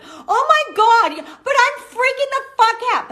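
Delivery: hammed-up meltdown, stretching the words out; mock panic. Her panicked call acted.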